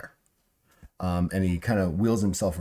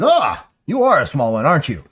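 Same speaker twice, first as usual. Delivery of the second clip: high, loud exclamation; delighted surprise; 7 semitones higher, 9 dB louder than usual. Acted. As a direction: exclaim at a surprising little newcomer. Figure exclaims on meeting small Ziggy.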